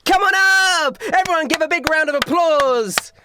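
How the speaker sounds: high, loud carnival hype; big high-pitched announcer call; stage magician showman. Acted. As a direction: whip up applause for the volunteer. Magician calls volunteer onto stage.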